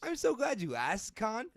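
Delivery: high, bright announcer sign-off; hammy host voice. Pretend. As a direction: play it like a cheesy radio host. Hammed-up podcast host sign-off.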